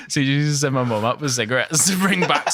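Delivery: usual speaking voice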